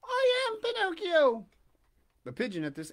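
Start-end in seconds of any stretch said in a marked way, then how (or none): 0.0-1.5 loud, very high, drawn-out declaration; excited Pinocchio. A narrator's reading. Puppet jumping up to answer the pigeon, high loud cry. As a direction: announce it in a high puppet voice, stretched out. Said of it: "jumping quickly to his feet".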